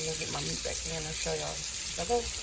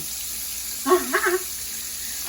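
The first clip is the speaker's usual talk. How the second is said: airy laugh; 10 semitones higher, 3 dB louder than usual. Real, not acted. Laughs after "what the hell.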